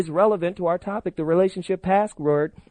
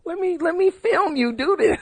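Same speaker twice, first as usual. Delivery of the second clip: mock coaxing plea; 11 semitones higher than usual. He coaxes his girlfriend to film.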